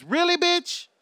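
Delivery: high, scornful "really"; incredulous. Acted. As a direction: sneer in disbelief. Mocking disbelief.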